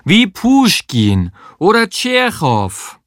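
put-on high, slow Russian-accented call; exaggerated Russian accent. A narrator's reading. Sabine naming writers from the bathroom, hammed-up Russian accent. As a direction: draw the names out in grand Russian accent. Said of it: "so russisch sie konnte".

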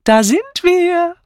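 high, slow cheerful announcement; cheerful arrival of Mrs Swettenham. A narrator's reading. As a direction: sing out your arrival. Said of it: "verkündete sie fröhlich".

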